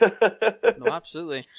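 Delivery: bursts out laughing on the word. Real, not acted. Laughing at guest's goal.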